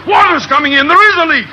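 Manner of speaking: high, frantic panic, rising to a shout; losing his nerve completely as water comes in; panicky. Acted. Captain panics as boat sinks.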